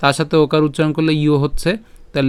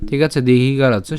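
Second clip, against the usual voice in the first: usual speaking voice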